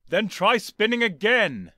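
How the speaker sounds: loud, slow, high yell. Acted. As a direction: bellow the order again. The Doctor yelling order.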